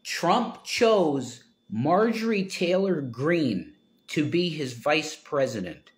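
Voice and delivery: Trump chose Marjorie Taylor Greene drawn out in disbelief; slow disbelieving repeat. Shown as a demonstration. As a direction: repeat the news slowly, stunned.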